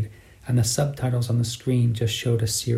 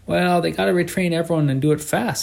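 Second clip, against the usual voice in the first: put-on sing-song northern accent; 6 semitones higher than usual. A narrator's reading. Cabbie explaining the retraining, northern Argentine lilt. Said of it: "in the sing-song accent of the northern provinces".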